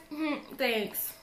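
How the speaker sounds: quiet, awkward acknowledgement; mumble-soft, reluctant politeness; uncomfortable thanks. Retold or imitated. Teen passenger answering a creepy cab driver's compliment, uncomfortable. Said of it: "I was so uncomfortable".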